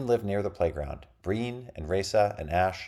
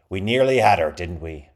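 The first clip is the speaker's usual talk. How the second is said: pirate captain voice; 8 dB louder than usual. A narrator's reading. Wounded captain speaks.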